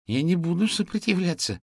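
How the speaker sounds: put-on high, loud, anxious child voice; high-pitched plea of surrender; alarmed boy. A narrator's reading. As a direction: protest hastily as a frightened boy. Alarmed boy pleads not to resist.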